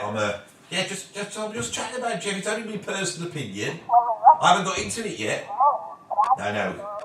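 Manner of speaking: quiet phone chat; hushed phone talk. Real, not acted. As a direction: murmur away from the mic. On a phone call.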